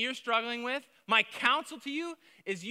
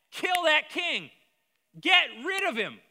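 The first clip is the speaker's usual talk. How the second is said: slow building to a higher, louder shout; rising shout; 8 semitones higher, 7 dB louder, slower than usual. Real, not acted. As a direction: hammer it home louder each line. Building preaching climax.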